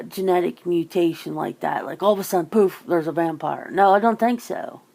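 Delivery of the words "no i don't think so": usual speaking voice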